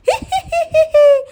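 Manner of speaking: high, drawn-out evil cackle; witch's cackle. Acted. Witch laughs before leaving. (चुड़ैल की ऊँची डरावनी हँसी)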